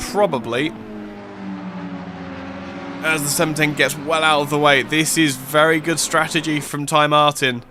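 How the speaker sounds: fast, lively play-by-play call; live race commentary. Acted. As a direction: call the final laps like live sport.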